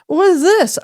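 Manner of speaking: high, slurred question through gauze; imitating groggy patient. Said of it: "'cause she's pointing to, like, the gauze".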